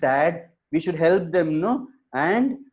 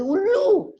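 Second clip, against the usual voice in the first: "Ullu" shot up very high; startled cry; 4 dB louder than usual. Acted. Monkey woken by the owl, crying "Ullu" in high alarm.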